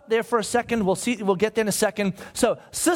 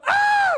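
high, loud yelp of fright; mock scream, very high-pitched; startled cry; 4 dB louder than usual. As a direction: cry out as if someone jumped out at you. Soldiers cry out, startled by Boo.

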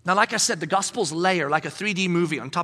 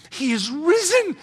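drawn out, a bit higher; slow solemn proclamation; 7 semitones higher, 4 dB louder, slower than usual. Pretend. Angel proclaiming to the women, slow and solemn. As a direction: announce it with slow awe.

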